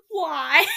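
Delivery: high, loud helpless laughter; can't stop laughing; laughing fit. Real, not acted. Cringing at her teenage writing, a loud laughing fit.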